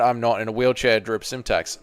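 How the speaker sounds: ordinary talk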